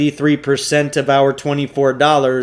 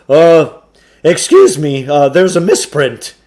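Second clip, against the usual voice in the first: higher, louder salesman's patter; Samuel Klugarsh voice; 4 semitones higher, 10 dB louder than usual. Acted. Shopkeeper pushing a trade-in on the boys, higher, louder salesman voice. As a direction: sell it like an oily bookshop owner.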